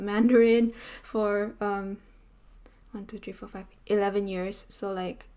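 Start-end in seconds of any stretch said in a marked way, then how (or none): 2.9-3.7 counting softly under her breath; whispered counting. Real, not acted. Counting years to herself, whispered.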